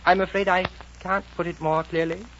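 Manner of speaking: put-on high, hesitant girl's voice; Alice. Alice voiced in story reading.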